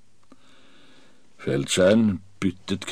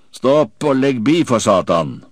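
loud, raised-pitch order; shouted command; 6 semitones higher, 8 dB louder than usual. A narrator's reading. Silver halts the cackling pirates with a shout. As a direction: shout to cut the noise dead. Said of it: "ropte jeg".

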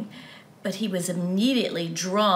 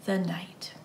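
softly whispered close of the sentence; 3 semitones lower, 7 dB quieter than usual. A narrator's reading. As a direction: breathe the words out as a hushed whisper. Solemn end of sentence.

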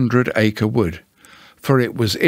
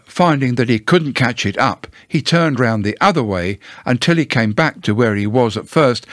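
ordinary delivery, nothing marked

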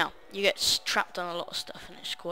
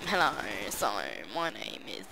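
a mock woman's voice in a lower register; generic female voice; 10 semitones lower than usual. Youtuber imitating a friend's stock female voice, mock lady. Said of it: "that's the generic female voice that he made up".

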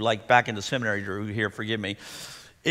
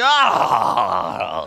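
sharp, high, loud gasp; stressed gasp; 7 semitones higher, 5 dB louder than usual. Shown as a demonstration. Pastor showing his bad morning breath, a stressed gasp. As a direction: gasp like you're already overwhelmed. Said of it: "is not a prayer breath".

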